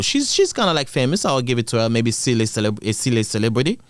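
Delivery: normal talking voice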